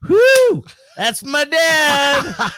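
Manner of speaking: high, loud whoop stretched out long; daughter's proud yell. Retold or imitated. Daughter at the bar outing dad on stage, high drawn-out yell. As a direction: holler it across a crowded bar. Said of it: "she goes; when she yelled that".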